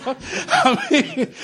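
normal talking voice

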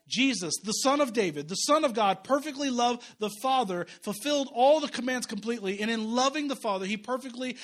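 loud, high preaching build. Acted. Pastor to congregation, loud high preaching. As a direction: lift the room as you preach. Preaching at full force about Christ.

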